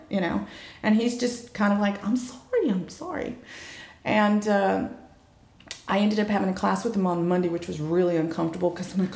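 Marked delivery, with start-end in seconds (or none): 2.0-3.4 higher-pitched imitation of an apologetic boy; mock contrite little voice; sheepish apology. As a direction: apologize meekly, dropping softer on the repeat.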